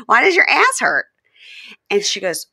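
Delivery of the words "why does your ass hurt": high, loud and laughing; half-laughing amused imitation; laughing mock question. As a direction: giggle through the question. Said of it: "And he goes".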